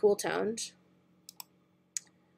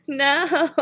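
ordinary talk

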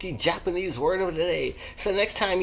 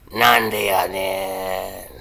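low, very slow and loud; deep booming delivery; 10 semitones lower, 6 dB louder, much slower than usual. Shown as a demonstration. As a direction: boom it out like a big announcer. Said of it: "walk up to 'em and say".

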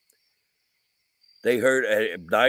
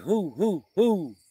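higher mock owl hooting; owl hoots; 6 semitones higher, slower than usual. Explaining normal owl calls to viewers, higher mock hooting. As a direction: hoot it like an owl in the woods. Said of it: "normally owls like".

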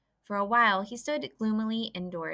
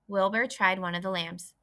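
plain reading voice

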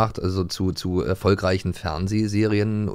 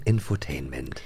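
dreary disappointment; 7 semitones lower, 6 dB quieter, slower than usual. Acted. Mock dread at the word.

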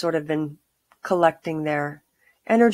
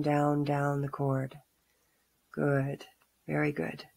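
calm grounding exercise. Acted. Guided grounding meditation.

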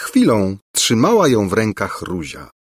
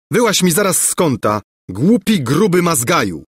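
a hammed-up angry princely taunt, higher; mock taunt; 7 semitones higher than usual. A narrator's reading. Prince taunting rival Bulbo, mock angry. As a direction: goad the fat rival out of the corner. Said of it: "zawołał Lulejka".

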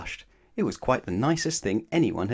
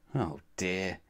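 rueful aside; 3 semitones lower, 7 dB quieter than usual. A narrator's reading.

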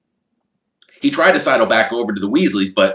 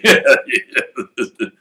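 showy laugh; 4 semitones higher, 5 dB louder than usual. Acted.

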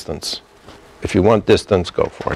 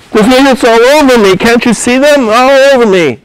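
high, loud acted panic; frantic patient, pitched way up; panicked hallucination; 13 semitones higher, 6 dB louder than usual. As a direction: cry out as if things are crawling on you.

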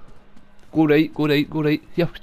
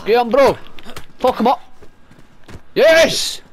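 excited cheer; 5 semitones higher, 5 dB louder than usual. Real, not acted. Cheering teammate during kill.

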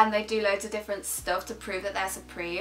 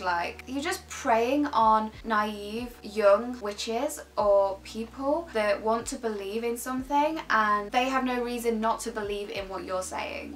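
normal talking voice

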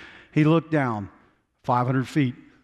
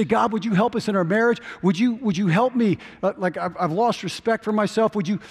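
raised, pleading prayer voice; 7 semitones higher than usual. Retold or imitated. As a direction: beg God for help, voice up high. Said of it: "We say".

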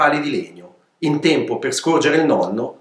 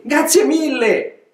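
much higher, slow, drawn-out thanks; effusive thanks; 10 semitones higher, slower than usual. Acted. Old donkey thanking the gnus, gushing. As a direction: stretch out grazie with delight. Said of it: "rispose Celso".

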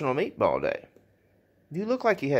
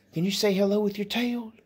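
much higher, sing-song pet talk; 9 semitones higher than usual. Real, not acted. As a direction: coax the dog in a high cooing voice. Asking the dog again.

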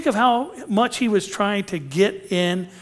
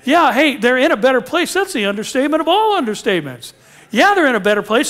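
loud, high, emphatic proclamation; exultant preaching; 7 semitones higher, 6 dB louder, faster than usual. Real, not acted. Preacher celebrating heaven to the congregation, exultant. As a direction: proclaim it like fantastic news.

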